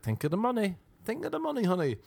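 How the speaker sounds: high put-on coaxing voice; sing-song high pep talk to yourself; coaxing himself.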